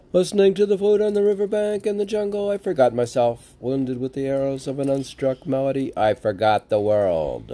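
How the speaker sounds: fast, high, loud recitation of the verse; poem recital. Acted. Reciting his mystical poem aloud, declaimed with fervor. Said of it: "reciting the poem".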